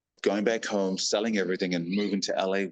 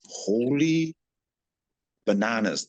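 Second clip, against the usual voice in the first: sharp gasp, then an amazed exclamation; gasp of shock; 3 semitones higher, slower than usual. Retold or imitated. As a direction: gasp and blurt out your astonishment. Shocked at the offer.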